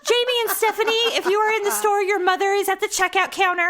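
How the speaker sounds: imitated cashier paging children; high, sing-out announcement to the whole store; cashier's store announcement. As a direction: page two lost kids as a cashier. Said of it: "they would have the cashier summon you".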